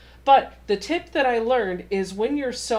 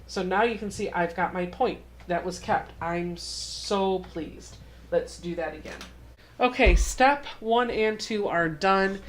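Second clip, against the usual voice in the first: ordinary talk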